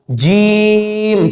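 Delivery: "जीम" with a very long held vowel; drawn-out letter name. Shown as a demonstration. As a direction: stretch the ee of "जीम" slowly on a level note. Teacher models letter name for class.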